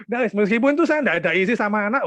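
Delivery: ordinary talk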